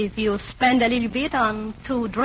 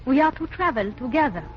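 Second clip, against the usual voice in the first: put-on a light French accent, flirty dancer. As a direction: coquettish French dancer introducing herself.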